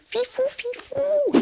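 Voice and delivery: extremely high, slow cooing; cutesy squeak. Pretend. Savouring a catchphrase she finds cute, squeaky voice. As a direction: draw it out in a tiny high-pitched voice. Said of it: "je trouve ça trop mignon".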